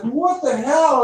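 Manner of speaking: high, slow shocked "what the hell"; retold what the hell. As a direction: replay the moment the pain hit, disbelieving. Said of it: "I was like".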